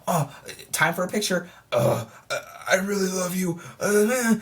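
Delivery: hammed-up weirdo, moaning between phrases; creepy fan. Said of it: "Why are you acting so creepy".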